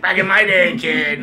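high, loud mock veteran's bark; hammed-up old-timer calling out to a youngster; grizzled old-timer. Joking about being a locker room veteran, grizzled old-timer voice. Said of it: "like these old grizzled leaders".

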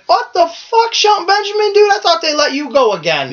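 very high-pitched incredulous outburst, easing down; retold astonishment. Host recalls reacting to Shelton Benjamin's return, high-pitched retold astonishment. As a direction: squeal it in mock disbelief, then settle. Retelling shock at surprise return.

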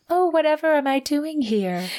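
high, put-on damsel voice; mock heroine. Co-host imitates heroine arriving at his house, mock innocence. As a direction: play the heroine with hammed-up wonder.

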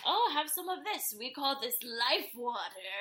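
playful put-on voice, slowing toward the end; old Greek man. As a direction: imitate an old man proudly presenting his liquor. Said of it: "one of the old men in the Greek family goes".

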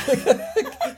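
high, loud laugh; amused laugh. Real, not acted. Co-host amused by the pay-rise scenario, loud laughing. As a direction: burst out laughing, high-pitched.

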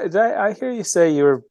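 ordinary talk

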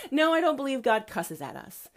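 ordinary talk, nothing marked

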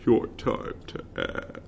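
slow breathy whisper. Retold or imitated. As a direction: whisper it like a teaser ad.

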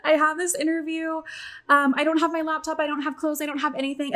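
high-pitched imitation of crying complaint; mock tearful. Crying guest to hotel staff at four A M, mock tearful. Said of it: "And then I just start crying".

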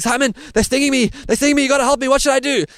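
high, loud, frantic mock pleading; pleading for help, pitched right up in retold panic; panicked plea. Stung narrator begging beekeeper Simon for help, high frantic plea. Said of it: "I'm saying".

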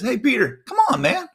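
high, casual calling-over voice; playful high-pitched invitation; Jesus. Retold or imitated. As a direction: beckon a friend over, pitch climbing. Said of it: "And Jesus says".

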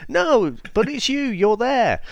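high, cheeky insistence; a lightly voiced Austin Powers; Austin Powers impression. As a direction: point at them like Austin Powers, grinning. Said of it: "Austin Powers".

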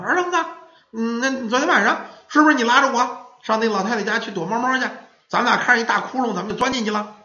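put-on high, insistent little-boy voice; questioning child. A narrator's reading. Boy asking his friend about last night, high insistent voice. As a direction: press your friend for an answer.

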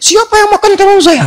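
high, mock outraged cry; retold shout. Wife crying out high over her missing eggplant. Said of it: "dia teriak".

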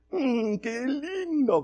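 high, very slow dreamy coo; gleeful daughter. Retold or imitated. Daughter admires the snake on her neck, cooing slowly. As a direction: coo it out with a wicked little grin. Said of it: "con una sonrisa sabática".

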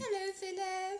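high, bright posh telephone greeting; posh mother on the phone. Retold or imitated. Imitating his mum on the phone, high posh hello. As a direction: answer the phone like a grand English mum. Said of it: "caught a syllable or two while you were on the phone".

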